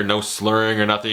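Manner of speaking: ordinary talk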